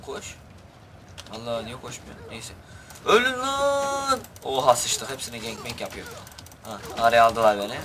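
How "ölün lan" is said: very high, slow stretched yell of "Ölün lan"; "lan" held long at the top of the voice; drawn-out war cry. Acted. Charging enemies again in game.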